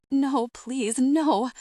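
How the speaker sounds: loud, high pleading cry; begging through a howl of grief; anguished, rising loud cries; desperate wail. Acted. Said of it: "Mom howls like a rabid animal".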